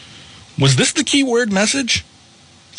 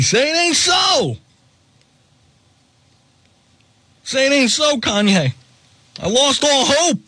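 mock lament; 6 semitones higher than usual.

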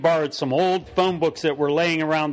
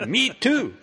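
excited; 8 semitones higher than usual. Acted. Echoing the excited exclamation.

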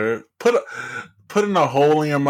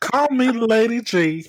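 higher, slow Southern drawl; Southern accent; 11 semitones higher, slower than usual. Retold or imitated. Mimicking the politician in his Southern accent. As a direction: purr it like a Southern belle. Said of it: "in his little Southern accent".

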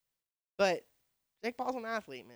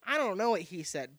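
usual speaking voice

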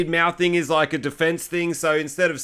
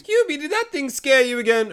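high, put-on old lady calling out; old mum voice; 10 semitones higher than usual. Hubie's mum calling to him from inside the house. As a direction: call out like a sweet old mum.

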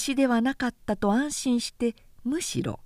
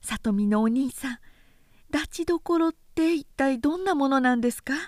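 higher, hesitant sister voice; timid sister; 5 semitones higher than usual. A narrator's reading. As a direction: ask shyly, a little afraid. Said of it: "おずおずと兄にこう聞いた".

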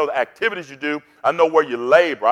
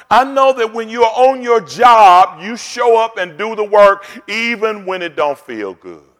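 full-force preaching; 4 semitones higher, 4 dB louder than usual. Acted. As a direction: preach it out to the congregation with fire.

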